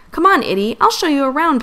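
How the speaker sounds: can't wait to show her; young prince eager to give tour; eager invitation. Acted. Excited prince inviting guest.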